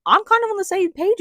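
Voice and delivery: high, emphatic agreeing aside; emphatic agreement. Real, not acted. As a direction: side with him out loud. Agrees with the king.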